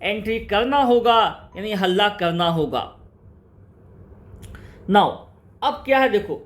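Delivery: ordinary talk, nothing marked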